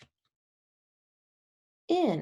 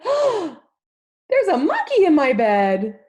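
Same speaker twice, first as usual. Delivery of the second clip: high, loud shocked cry; startled shout; 10 semitones higher, 10 dB louder than usual. Acted. Zookeeper's wife shouting in shock at the monkey. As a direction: gasp it out as if you found a monkey. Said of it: "She turned on the light and said".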